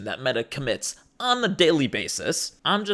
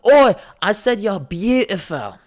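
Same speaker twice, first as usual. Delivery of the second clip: put-on louder British lad accent, raised over noise; shouting British lad; 5 semitones higher, 6 dB louder than usual. Club guy repeats himself over the noise, louder British accent. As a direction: bellow it in a brash British accent. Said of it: "And he goes".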